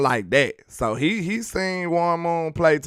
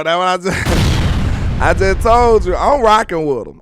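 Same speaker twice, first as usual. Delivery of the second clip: laughing; 4 semitones higher, 6 dB louder than usual. Real, not acted. Laughing as he insists.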